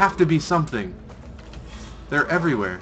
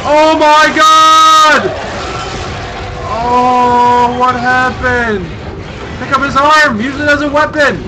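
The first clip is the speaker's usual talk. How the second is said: higher, louder frantic shouts; panicking gamer; 11 semitones higher, 12 dB louder, slower than usual. Real, not acted. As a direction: yell in shock and panic. Panicked shouting during fight.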